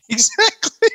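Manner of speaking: high, laughing agreement; helpless laugh into the word. Real, not acted. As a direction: burst it out through laughter. Both cracking up in agreement.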